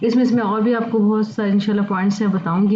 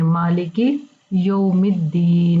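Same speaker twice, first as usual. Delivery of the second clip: slow recitation; 2 semitones lower, much slower than usual. Shown as a demonstration. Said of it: "यानी उर्दू तलफ़ुज़".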